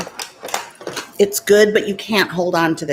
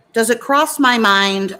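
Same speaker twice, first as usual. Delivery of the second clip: normal talking voice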